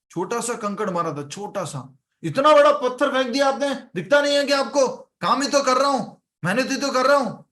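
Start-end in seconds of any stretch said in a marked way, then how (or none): 2.2-7.4 angry complaint. Retold or imitated. Worker shouting at the engineer who threw the pebble, indignant. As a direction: yell up at him, outraged over a pebble.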